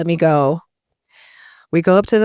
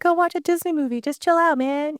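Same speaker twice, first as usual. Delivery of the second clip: much higher, lightly voiced calm mom; mom's voice; 10 semitones higher, 3 dB quieter than usual. Mom on the phone talking her down, higher voice. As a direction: put on your mom soothing you over the phone. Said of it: "She's like".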